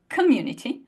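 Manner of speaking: community with an aspirated k; breathy k in community; English community. Shown as a demonstration. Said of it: "Can you feel the difference".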